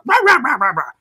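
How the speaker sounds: high, loud barking rah rah; dog barking. Retold or imitated. Retelling a film to a friend, barking like the dog. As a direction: bark it out like a sheepdog.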